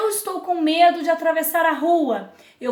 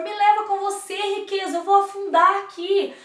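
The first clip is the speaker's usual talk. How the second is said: higher pleading voice for Love; 6 semitones higher than usual. Acted. Love begging Wealth to take him, pleading. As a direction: ask desperately. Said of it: "E aí o amor".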